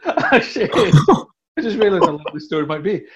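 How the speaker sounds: loud, laughing realisation; words tumbling out through real laughter; laughing while talking. Real, not acted. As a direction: burst out laughing mid-sentence and carry on. Cracks up at own long story.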